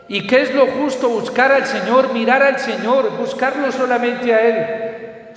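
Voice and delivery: preacher's climax, calling out; fervent preaching. Acted. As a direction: hammer each al Señor home.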